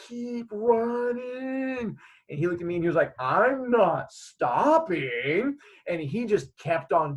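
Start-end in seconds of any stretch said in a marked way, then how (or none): 0.1-2.0 long, drawn-out high call; slow-motion plea to a brother; brotherly urging. Retold or imitated. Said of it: "like, this whole brotherly". 3.2-5.6 slow, stretched-out refusal; slow-motion answer on the run; little brother's reply. Retold or imitated.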